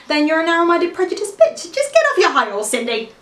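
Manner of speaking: high-pitched, mounting indignation; indignant telling-off. Acted. Defends boyfriend to girlfriends in acted rant.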